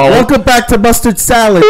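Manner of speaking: mock podcast host. Trying out a show intro.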